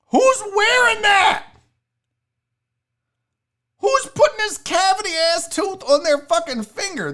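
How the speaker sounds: high, loud disbelief; incredulous high-pitched outburst; outraged shout. Real, not acted. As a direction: yell it out, appalled at the very idea. Outraged at a dirty tooth ring.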